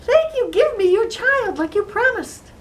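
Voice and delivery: gleeful, high-pitched little man voice; happy little man. Acted. Little man demands the promised child, gleeful. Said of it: "he was so happy".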